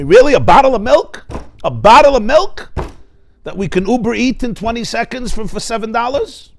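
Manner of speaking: loud, high, indignant outburst; angry husband. Retold or imitated. Husband snaps at his wife over milk, loud and indignant. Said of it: "He becomes angry".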